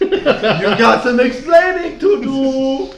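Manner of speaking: high, slow put-on scold; a drawn-out silly scolding; mock scolding. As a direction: wag a finger in a silly voice.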